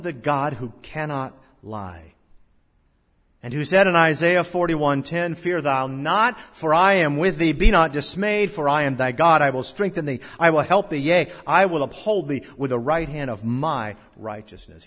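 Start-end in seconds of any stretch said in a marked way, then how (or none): 3.4-14.8 loud, higher preached scripture; thundering sermon voice; full-force preaching. Acted. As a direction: proclaim the promise with power.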